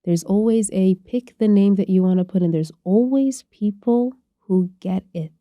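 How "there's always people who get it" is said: slow emphasis. Real, not acted.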